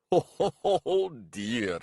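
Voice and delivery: high burst of mocking laughter into words; laughing. A narrator's reading. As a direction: laugh off the young man's boast. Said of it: "laughed Garalko".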